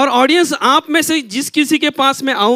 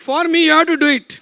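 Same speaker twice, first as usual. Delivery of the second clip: pleading; 5 semitones higher, much slower than usual. Real, not acted. Coaxing volunteer to shout.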